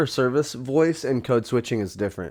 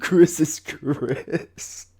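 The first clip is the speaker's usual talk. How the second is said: high, very slow mocking echo of the line; drawn-out parody of a friend's line; 7 semitones higher, much slower than usual. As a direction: stretch it out, teasing.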